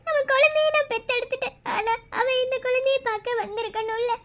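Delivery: tearful, sob-broken lament; crying. Acted. New mother aches for her missing lover, crying. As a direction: weep while insisting on having him back. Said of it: "இதுக்கு மேல அழுகாத".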